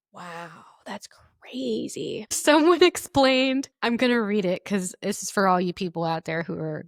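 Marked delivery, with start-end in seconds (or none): 0.1-2.3 breathy whispered disbelief; hushed disbelief; stunned whisper. Real, not acted. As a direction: whisper it in shock. Stunned reaction.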